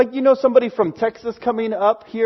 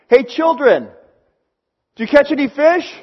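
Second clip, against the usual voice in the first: higher-pitched hail across water; calling out; 7 semitones higher, 4 dB louder than usual. Retold or imitated. Stranger on the seashore to fishermen, calling out. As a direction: call it to a far-off boat.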